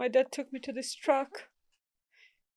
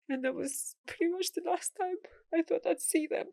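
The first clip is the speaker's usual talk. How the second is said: choked up; 8 semitones higher than usual. Real, not acted. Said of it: "It's just been emotional".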